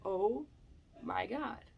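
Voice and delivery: soft amazed murmur; awed hush. Retold or imitated. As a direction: breathe it out in awe. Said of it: "I was like".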